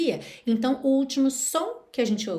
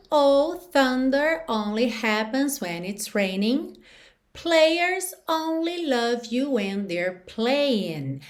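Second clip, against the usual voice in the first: slow demo; 2 semitones higher, slower than usual. Shown as a demonstration. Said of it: "teacher reads chorus slowly".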